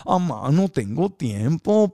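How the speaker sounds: put-on slow, drawn-out "Amá, no tengo tiempo" in a Tijuana accent; Tijuana whine. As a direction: whine it to mom, Tijuana style. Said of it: "Con el acento". ("Amá" quejumbroso con acento de Tijuana)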